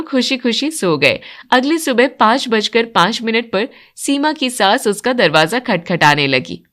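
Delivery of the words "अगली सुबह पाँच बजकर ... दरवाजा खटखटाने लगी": ordinary delivery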